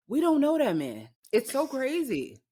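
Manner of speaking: high, loud exasperation; exasperated. Real, not acted. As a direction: throw up your hands in disbelief. Exasperated outburst about [name].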